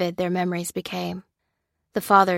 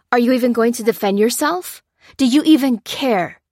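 higher, louder demand; 5 semitones higher, 7 dB louder than usual. A narrator's reading. Lopez demanding Lucy defend herself, loud demanding voice. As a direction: press her hard for an answer. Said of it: "demanded Lopez".

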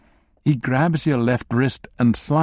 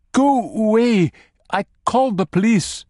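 panicked yell; 9 semitones higher than usual. Acted. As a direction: scream at the intruder to leave. Captive yells in panic.

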